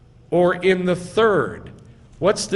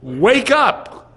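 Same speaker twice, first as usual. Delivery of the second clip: high, loud, punched command; forceful wake-up command; emphatic imperative; 7 semitones higher, 5 dB louder than usual. Shown as a demonstration. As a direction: hit it as an order, not advice. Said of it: "It's an imperative, emphatic".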